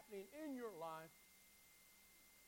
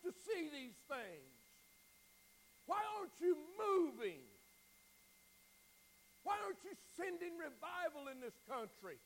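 mock scream; 5 semitones higher than usual. Preacher acting Habakkuk screaming at God, anguished cry. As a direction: preach it as a desperate scream to heaven. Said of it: "he's screaming to the top of his lungs".

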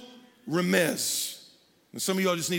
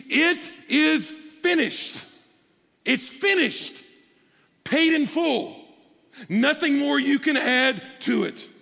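preaching climax; 4 semitones higher, slower than usual. Acted. Climax of sermon.

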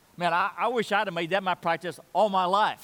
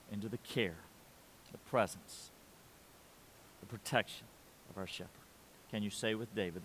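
hushed appeal; 6 semitones lower, 8 dB quieter than usual. Real, not acted. Pastor quietly asking the congregation to trust, soft and low. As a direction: drop to a soft, low, intimate question.

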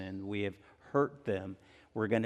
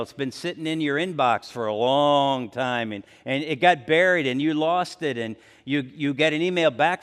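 ordinary delivery

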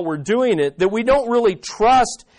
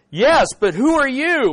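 desperate man; 7 semitones higher, 4 dB louder than usual. Acted. Man on cliff questions voice.

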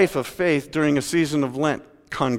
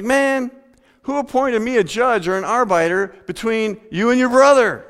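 exasperated Jesus; 8 semitones higher, 3 dB louder than usual. Acted. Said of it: "He's like".

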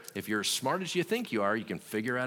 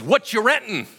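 put-on loud kid question; 12 semitones higher, 11 dB louder than usual.

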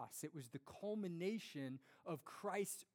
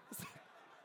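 breathy laugh; 10 dB quieter than usual. Real, not acted. Laughs at punchline.

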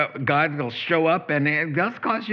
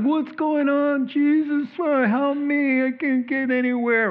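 panicked plea; 10 semitones higher than usual. Retold or imitated. Panicked prayer for help.

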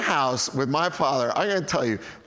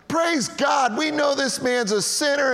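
high, shouted mock praise; hammed-up smug accusers; mocking Pharisees; 11 semitones higher than usual. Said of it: "they're like".

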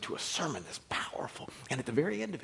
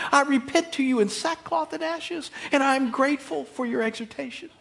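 mock gratitude; 8 semitones higher, 8 dB louder than usual. Preacher plays a husband thanking his wife for correction, mock-grateful. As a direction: gush with hammed-up thankfulness. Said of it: "I know your natural response is".

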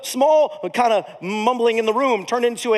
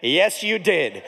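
whole room answering; 4 semitones lower, much slower than usual. Retold or imitated. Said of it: "the entire room collectively went".

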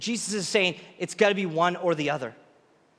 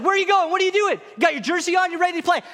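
high, loud disbelief; a coach who can't believe his player is walking out; baffled coach yelling after his player; incredulous coach; 10 semitones higher, 4 dB louder, faster than usual. Pretend.

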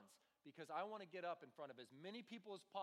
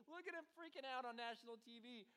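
mock gleeful announcer; 6 semitones higher than usual.

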